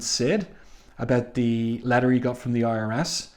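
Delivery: normal talking voice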